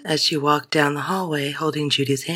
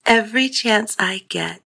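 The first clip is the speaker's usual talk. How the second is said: put-on young girl voice; 6 semitones higher than usual. A narrator's reading. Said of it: "Judy murmured".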